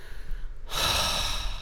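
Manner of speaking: long, soft breathy exhale; long sigh. Real, not acted. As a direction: let out a wistful sigh. Longing to get to Stonehenge.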